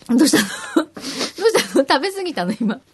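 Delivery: asking through real giggles; laughing. Real, not acted. Host teasing guest who ate too much, laughing. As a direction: laugh helplessly while asking what happened. Amused at co-host's eating mishap.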